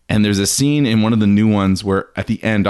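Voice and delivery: usual speaking voice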